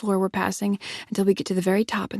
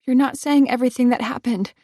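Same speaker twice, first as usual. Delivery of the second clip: high, loud accusation; accusing; 5 semitones higher, 6 dB louder than usual. Acted. Heroine accusing Evan of hiding the truth, loud. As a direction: press him hard.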